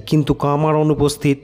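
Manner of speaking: ordinary delivery, nothing marked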